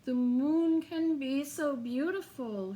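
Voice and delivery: ordinary delivery, nothing marked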